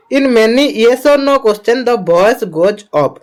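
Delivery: reads English very slowly; slow dictation reading. Acted. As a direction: spell it out slowly for students.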